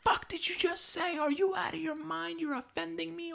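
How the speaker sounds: very high falsetto outrage; mock offended type. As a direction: squeal it as a hammed-up easily offended person. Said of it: "could have been one of those".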